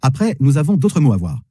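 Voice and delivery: ordinary talk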